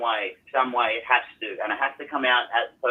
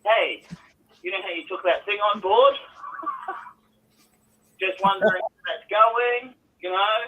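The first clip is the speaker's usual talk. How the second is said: teasing reminder; 7 semitones higher than usual. Retold or imitated. Teasing wife about promise.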